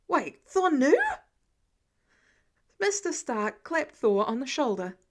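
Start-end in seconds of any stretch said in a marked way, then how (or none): 0.1-1.0 louder, higher, startled; shocked realisation. A narrator's reading. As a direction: snap up in alarm. Peter's sudden realisation.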